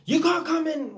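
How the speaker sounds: high, loud frantic yelling; imitated shouting. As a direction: shout it through the door in a panic. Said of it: "He's banging on the door".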